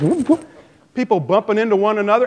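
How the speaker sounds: ordinary delivery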